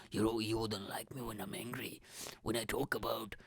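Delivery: put-on Hulk with a Scottish accent. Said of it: "sound like a mixture between Hulk and a Scottish guy".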